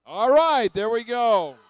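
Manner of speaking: game announcer. Real, not acted. Announcing alliance set to crowd.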